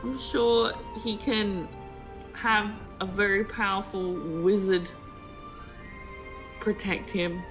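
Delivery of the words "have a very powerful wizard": normal talking voice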